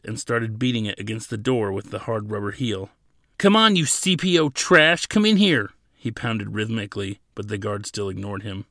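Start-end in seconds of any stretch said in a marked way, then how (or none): 3.4-5.7 loud, raised-pitch challenge; shouted taunt. A narrator's reading. Prisoner beating the door at the ignoring guard, shouting. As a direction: yell to force a response.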